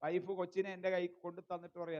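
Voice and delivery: usual speaking voice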